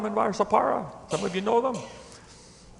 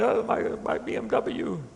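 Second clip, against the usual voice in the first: higher, softer mock whimper; mock crying; 4 semitones higher, 8 dB quieter than usual. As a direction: imitate a man tearfully lamenting. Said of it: "he's crying".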